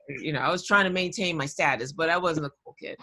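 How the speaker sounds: normal talking voice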